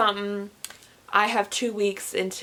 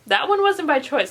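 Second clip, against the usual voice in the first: higher, louder insistence; louder insistent repeat; emphatic; 6 semitones higher, 5 dB louder than usual. Real, not acted. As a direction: stress it like a complaint. Repeats after laugh, emphatic.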